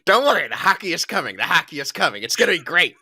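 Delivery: high, quick pretend promise; hammed-up stalling of eager listeners; mock reassurance. Podcaster parodies endless promises to fans, mock reassurance.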